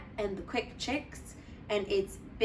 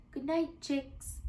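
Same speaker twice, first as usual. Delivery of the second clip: high, soft goodnight to the chicks; tender goodnight; 5 semitones higher, 4 dB quieter, slower than usual. Acted. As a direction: coo it gently. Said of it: "Splat said".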